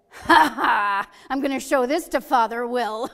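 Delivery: crowing with mischievous glee; gleeful laugh. Retold or imitated. Mom delighted with her fake boarding pass, laughing loudly. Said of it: "A twinkle came in her eye and a big grin".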